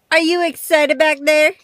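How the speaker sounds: loud, bright call to the dog; loud excited call. Real, not acted. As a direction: call back to the back seat. Asking dog in back seat.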